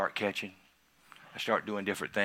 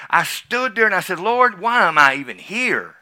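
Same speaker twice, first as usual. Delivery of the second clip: louder and higher, pleading to the Lord; raised retold prayer; 8 semitones higher, 11 dB louder than usual. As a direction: cry it out like you did that day. Retelling raw prayer in church.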